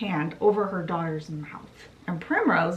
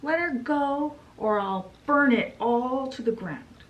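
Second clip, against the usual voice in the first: mild mock yell, pitched up; retold yell; 6 semitones higher than usual. Daughter threatens her mother over the flowers, mild mock yell. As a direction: threaten in a raised, higher voice. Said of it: "She yells at mo-, her mother".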